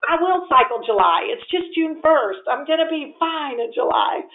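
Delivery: hammed-up outrage at husband, climbing to a shout; frantic retold rant to husband; mock protest.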